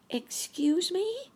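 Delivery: high, drawn-out polite question; polite asking. A narrator's reading. Sammy politely questioning Uncle Wiggily, high polite child character. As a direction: ask it high and slow, politely. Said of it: "asked Sammy politely".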